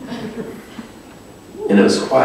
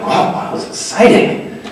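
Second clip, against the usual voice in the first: excited; 10 semitones higher than usual. Retold or imitated. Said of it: "I got excited".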